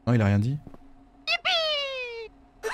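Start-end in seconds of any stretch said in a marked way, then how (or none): 1.3-2.7 squealed high yippee with a laugh; high cheer. Real, not acted. Gamer cheers as the ogre leaves, squealed high. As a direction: squeal it out, overjoyed.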